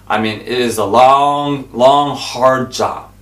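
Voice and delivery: high, loud pulpit preaching; loud revival preaching; fiery preaching. Acted. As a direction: preach it at full force. Preaching about digging wells.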